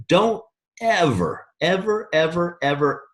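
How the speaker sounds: slow, drawn-out "Don't ever, ever, ever", each "ever" stretched; emphatic warning. Real, not acted. Coach warning the team, slow stretched "ever". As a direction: lean on every "ever", slowly. Warning recruits against begging.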